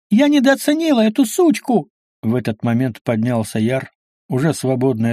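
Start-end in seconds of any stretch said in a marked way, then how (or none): 0.1-1.9 very high-pitched squealed warning. A narrator's reading. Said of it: "взвизгнула Астарта". (пронзительный визг)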